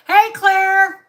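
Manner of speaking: loud high call across the house. Real, not acted. Caller breaking off her video to call Claire, loud. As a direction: shout the name to reach someone in another room. Calling out to someone.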